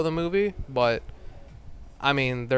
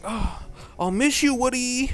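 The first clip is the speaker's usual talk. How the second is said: lightly voiced sad Bo Peep; 9 semitones higher than usual. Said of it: "Bo Peep's like".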